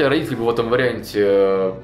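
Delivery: ordinary talk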